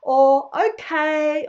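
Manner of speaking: cheerful automatic Australian reply; polite reply. Retold or imitated. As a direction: brush it off politely. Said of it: "You have to politely respond".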